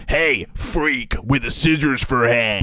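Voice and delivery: mock bully. Co-host playing the bully jeering at Edward Scissorhands, mock bully. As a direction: taunt slowly like a movie bully. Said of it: "The big bully guy's like".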